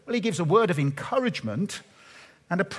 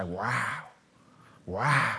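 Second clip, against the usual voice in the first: a low, soft, breathless wow; hushed awe; 7 semitones lower, 6 dB quieter than usual. Shown as a demonstration. Preacher showing the congregation how to marvel, hushed awe. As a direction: let the wonder drop into a hush. The awe he hopes listeners feel.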